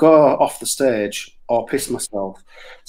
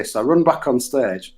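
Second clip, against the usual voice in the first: ordinary talk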